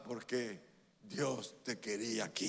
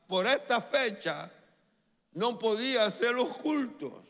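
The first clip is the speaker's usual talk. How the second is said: raised, forceful preacher delivery with spaced phrases; emphatic preaching; 7 semitones higher, 5 dB louder than usual. Real, not acted. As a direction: drive each phrase home to the congregation.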